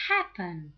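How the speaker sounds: happen with a loud HAP; stress on first syllable. Shown as a demonstration. Teacher contrasting verbs, happen stressed on HAP. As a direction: punch HAP in happen, drop the rest. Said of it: "happen has the stress on the first syllable".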